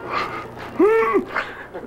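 hearty amused laugh. Real, not acted. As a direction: burst out laughing. Amused by old Sun records.

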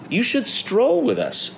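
high, drawn-out peacock voice; fancy invitation. A narrator's reading. Peacocks inviting Jim to stroll, high and inviting. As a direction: stretch it out slowly, up high.

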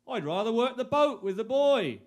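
ordinary talk, nothing marked

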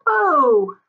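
ordinary delivery

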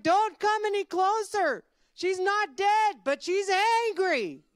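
high, loud, drawn-out alarm; shouted warning. Acted. Man up a tree warns villagers, shouting. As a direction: yell down a frantic warning, stretching key words.

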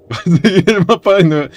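laughing repeat. Real, not acted. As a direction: say it through a helpless laugh. (repitiéndolo entre risas, más agudo)

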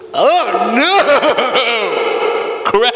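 drawn-out, over-the-top cry of dismay; weird reaction. Acted. Comic reacting to bad grades, mock dismay. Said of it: "like a weird reaction".

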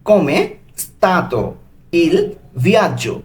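"Come stato il viaggio?" stretched very slowly; careful slow teacher reading of "Come stato il viaggio?"; slow model phrase. Shown as a demonstration. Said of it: "এর Italian কি হবে?".

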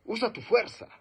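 high, punchy encouragement; motivating line. Shown as a demonstration. Speaker demos to class, a high punchy motivating call. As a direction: push them to use their strength. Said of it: "por ejemplo".

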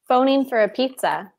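ordinary delivery

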